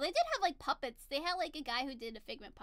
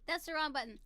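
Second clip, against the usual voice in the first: ordinary talk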